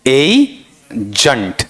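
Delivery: एजेंट drawn out as two parts, "ei" then "junt"; एजेंट split slowly with a short schwa in the second part; broken into sounds. Shown as a demonstration. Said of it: "इसमें कौन सा sound लाऊं".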